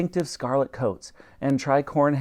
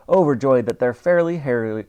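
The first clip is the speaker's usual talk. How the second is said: ordinary delivery, nothing marked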